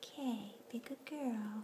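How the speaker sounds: soft whispered pet talk. Real, not acted. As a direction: whisper a gentle goodbye. Whispering to kitten.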